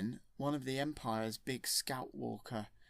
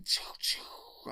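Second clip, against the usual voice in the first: breathy unvoiced laser noises; zapping sound effect. The Emperor firing lightning at Luke, whispered zap sounds. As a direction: spit out lightning zaps.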